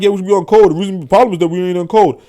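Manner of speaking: loud, high mock complaint, rattled off; mimicking the uninfluential. Mimicking people with no real influence, loud hurried imitation. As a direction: imitate people loudly urging unity, quickly. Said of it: "and they're like".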